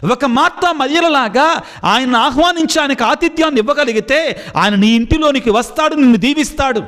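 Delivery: preaching at full force. Acted. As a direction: preach it out to the believers, fervent. Preacher exhorting the congregation. (ఉత్సాహంగా బోధించే స్వరం)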